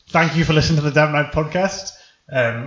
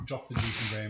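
muffled through a balaclava; speaking through a balaclava; 4 semitones lower, 12 dB quieter than usual. Acted. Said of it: "balaclava off now".

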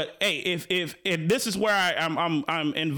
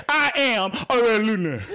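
hammed-up grand actor delivery, louder, laughing through it; mocking the actor's line; 4 semitones higher, 5 dB louder than usual. Host mocking the actor's Fred Hampton line, hammed-up and laughing. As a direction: parody the movie's overblown line. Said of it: "Fred didn't really talk like this".

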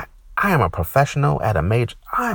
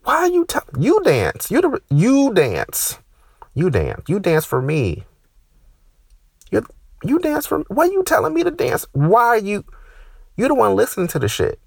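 indignant rant; 12 semitones higher than usual. Acted. Comic answering the receptionist, high outraged yell.

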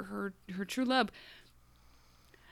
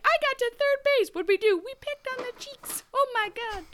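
much higher, squealing mock schoolgirl; giddy teen girl. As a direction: gush like a scandalized teenager. Mocking giddy teen girls.

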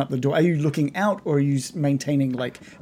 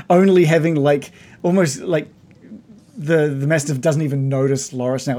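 ordinary talk, nothing marked

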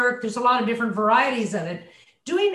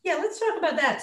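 ordinary talk, nothing marked

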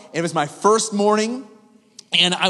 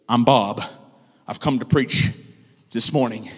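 deep-voiced guest preacher; 8 semitones lower than usual. Bob the guest speaker asks intern for the microphone, low and grave. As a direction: play him low and solemn, pausing. Visiting preacher introduces himself.

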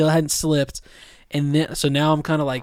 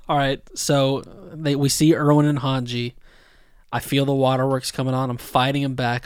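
ordinary talk

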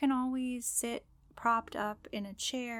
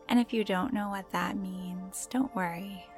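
guided meditation; slower than usual. Acted. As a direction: gently guide the listener into calm.

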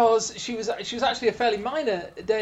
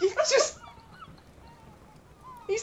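laughing. Real, not acted. Laughs while explaining the joke.

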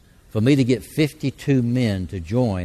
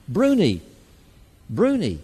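high called-out greeting; holler; 7 semitones higher than usual. Retold or imitated. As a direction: call it across the street. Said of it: "people holler out".

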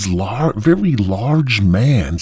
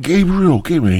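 higher, louder call for Gabriel; 6 semitones higher, 5 dB louder than usual. Acted. Lost man calls after Gabriel, who sent him back, calling out. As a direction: call out after someone who has gone.

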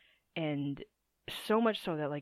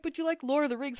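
mocking voice; 8 semitones higher, 4 dB louder, faster than usual. Mocking the friend's argument.

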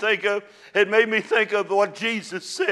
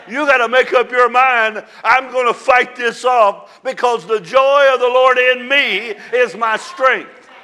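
louder, rising, fervent preaching; full-force preaching; 3 semitones higher, 5 dB louder than usual. Acted. Pastor rousing the congregation about joy in hard days, fervent preaching.

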